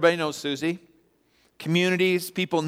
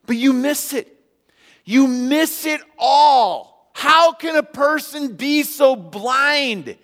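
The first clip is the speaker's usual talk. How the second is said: higher and louder, pleading at the congregation; full-force sermon climax; fiery preaching; 10 semitones higher, 5 dB louder than usual. Acted. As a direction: drive each question home from the pulpit.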